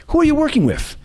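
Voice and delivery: put-on a chirpy society girl asking a friend; girlfriend voice. Friend in a cab asking about work, high eager voice.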